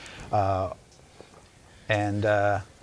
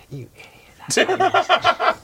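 much higher, louder exasperated voice; scolding villager; 6 dB louder than usual. Acted. Villager scolding another for calling the rescuer the monster. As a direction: snap at a foolish neighbor. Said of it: "another voice says".

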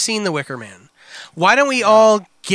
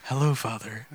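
very low, soft dead voice; creepy deadpan child greeting; monotone; 13 semitones lower, 11 dB quieter than usual. Pretend. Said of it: "monotone like that".

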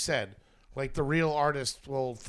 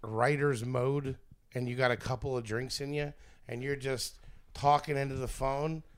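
usual speaking voice

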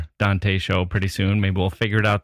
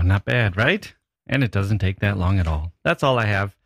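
ordinary talk, nothing marked

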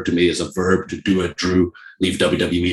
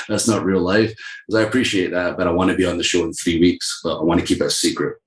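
ordinary talk, nothing marked

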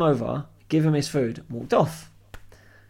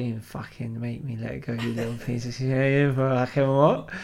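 put-on drunk Clive; 3 semitones lower than usual. Drunk regular refusing to let go of the barman's wrist, slurred and low. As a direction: drag the words out as a low drunken challenge. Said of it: "he's had too many to drink".

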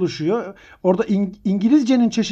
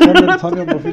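higher, louder mock indignation; mock angry shout; 9 semitones higher, 5 dB louder, faster than usual. Quarreling over the last conference pen, hammed-up angry shout. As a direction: puff up like an offended professor. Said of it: "birbirimize girdik".

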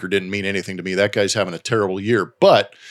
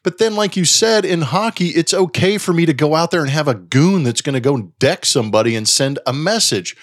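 usual speaking voice

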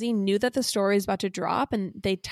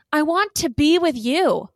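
loud, slow, higher-pitched declaration; all caps reading; 7 semitones higher, 7 dB louder, slower than usual. Acted. Host reads Jeff's all-caps text to co-host, loud declaration. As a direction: belt out each word as if in capitals. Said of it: "That was all caps".

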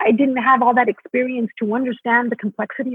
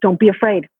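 louder, firm coaching push; mentor urging; 5 dB louder than usual. Retold or imitated. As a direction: urge her on like a mentor in the wings. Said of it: "He would say".

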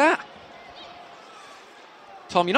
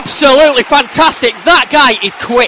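loud, thrilled praise; excited shout; 5 semitones higher, 8 dB louder than usual. Real, not acted. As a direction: cheer the strike. Reacting live to the goal.

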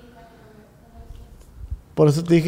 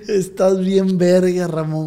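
ordinary talk

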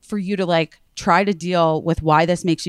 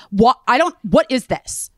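irate caller, higher and a bit louder; mock-indignant outburst; imitated yelling; 5 semitones higher, 3 dB louder than usual. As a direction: snap it like an annoyed man on the phone. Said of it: "yelling at me".

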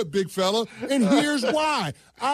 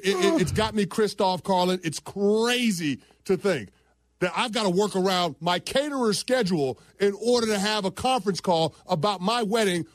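ordinary talk, nothing marked

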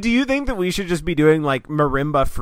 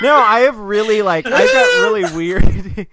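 loud laughing protest; 6 semitones higher, 9 dB louder than usual. Real, not acted. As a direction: laugh through the denial. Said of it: "you're bad at that".

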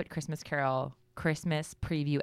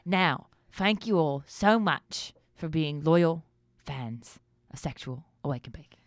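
Michael Caine impression. Co-host opens the Michael Caine segment in a mock Michael Caine accent. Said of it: "let's talk like Michael Caine".

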